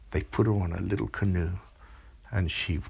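plain reading voice

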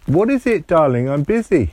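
a distracted mum calling back; busy mother. Mummy answers from upstairs.

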